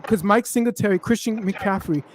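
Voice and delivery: normal talking voice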